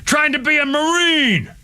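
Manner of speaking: high, drawn-out, anguished shouting; furious outburst. Real, not acted. Ex-Marine raging that his man dropped dead in training, furious. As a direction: shout each phrase slowly with high, outraged force. Enraged tirade over dead comrade.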